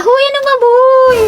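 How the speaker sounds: high-pitched exclamation; calling out. Acted. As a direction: call out high, with stretched vowels. Playful scolding of noisy classmates. (pasigaw na mataas ang boses)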